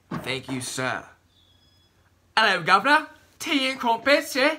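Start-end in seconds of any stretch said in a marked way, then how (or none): 2.4-4.5 high, loud hammed-up British accent; mock British accent. Joking to the camera in a hammed-up British accent. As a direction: play a cartoon Englishman in a British accent. Jokey British stereotype phrases.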